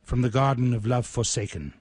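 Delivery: slow, measured poetry reading with long pauses; poem recital. Acted. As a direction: let each line land slowly, like a eulogy. Reads his poem aloud.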